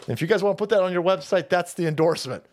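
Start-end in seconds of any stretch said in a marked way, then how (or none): none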